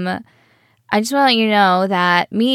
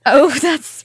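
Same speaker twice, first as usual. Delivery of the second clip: laughing; 6 semitones higher, 6 dB louder than usual. Real, not acted. Laughing at the mix-up.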